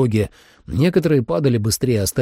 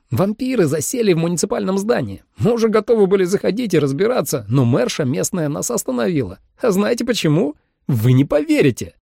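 high, animated storyteller; excited high-voiced chatterbox; chatty hunter; 10 semitones higher than usual. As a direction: play a talkative hunter, pitched well up. Narrator voices chatty hunter Gus.

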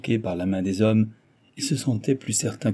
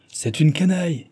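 high-pitched, indignant exclamation; vehement outburst; 7 semitones higher, 4 dB louder than usual. A narrator's reading. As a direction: denounce him with vehemence. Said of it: "clama monsieur de Glandève avec véhémence". (clamer avec véhémence)

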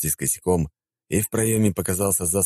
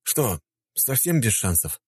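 slow, slightly higher, sighing question; resigned sigh; 6 semitones higher, slower than usual. A narrator's reading. Rejected visitor asking the secretary once more, sighing. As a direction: sigh it out slowly. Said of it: "вздохнул я".

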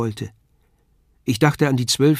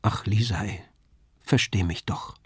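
soft, lowered plea; pleading; 3 semitones lower, 6 dB quieter than usual. A narrator's reading. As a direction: beg gently, dropping the voice. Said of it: "ich bat nur".

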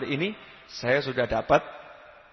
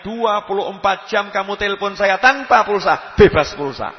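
loud, raised, building sermon delivery; impassioned preaching; 5 semitones higher, 8 dB louder than usual. Real, not acted. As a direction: pile up the praises with mounting force. Preacher builds up God's greatness.

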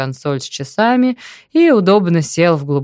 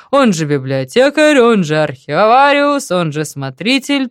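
mock-ominous voice, a touch higher and louder; sinister voice; 4 semitones higher, 5 dB louder than usual. A narrator's reading. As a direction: announce it like a menacing villain. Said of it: "зловещим голосом подхватил Толик". (зловещий голос)

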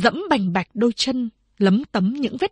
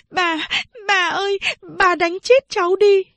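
high, rising tearful plea; crying while pleading; 10 semitones higher than usual. A narrator's reading. Granddaughter kneeling before grandmother, tearful pleading. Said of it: "vừa khóc vừa nói".

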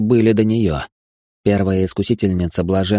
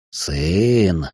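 stunned demon whisper; 3 semitones lower than usual. Acted. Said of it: "прошептал Баалор".

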